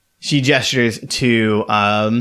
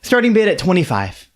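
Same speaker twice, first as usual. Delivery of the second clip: bright, higher auctioneer announcing the lot; 8 semitones higher than usual. Acted. Auctioneer announcing the winner to the room. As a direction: call the bid like a lady auctioneer. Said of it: "And she goes".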